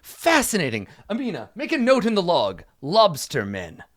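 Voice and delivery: high, theatrical knightly wonder; fascinated knight. Acted. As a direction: marvel grandly, dictating to your scribe.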